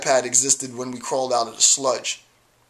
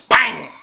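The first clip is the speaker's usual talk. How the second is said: mock gunshot; 9 semitones higher, 7 dB louder than usual. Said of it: "I could take out all my guns and say".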